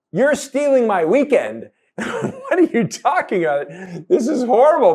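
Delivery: loud, very high-pitched mock tantrum; outraged child. Eldest son protesting to his parents about his weekend, outraged. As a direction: protest like a kid robbed of free time.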